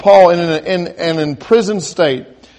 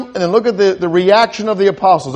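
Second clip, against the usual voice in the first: ordinary talk, nothing marked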